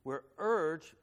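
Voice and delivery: usual speaking voice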